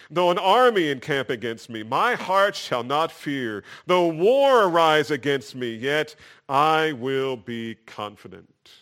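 defiant psalm verse proclaimed from the pulpit; preached scripture. Acted. Reads psalm verse three aloud.

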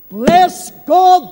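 usual speaking voice

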